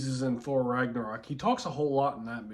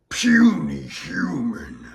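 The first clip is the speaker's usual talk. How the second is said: slow, loud lightly voiced brute; Hulk voice; 7 dB louder, slower than usual. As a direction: growl it like a brute. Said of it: "what's another one that Hulk always says".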